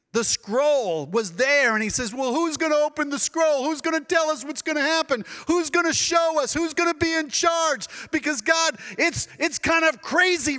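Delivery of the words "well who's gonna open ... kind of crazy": anxious questioning. Retold or imitated. Anxious people voiced, raised.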